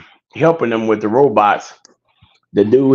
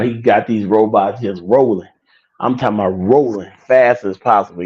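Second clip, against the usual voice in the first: normal talking voice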